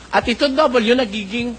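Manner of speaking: ordinary talk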